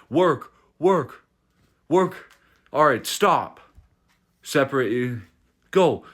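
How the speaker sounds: mock referee calling out short orders; imitated referee commands. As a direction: lightly act out an impatient referee. Acting out the hasty referee's commands.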